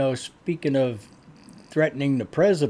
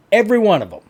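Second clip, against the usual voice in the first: 1 angry emphasis; 4 semitones higher, 6 dB louder, much faster than usual. Real, not acted. Host demanding protesters be arrested.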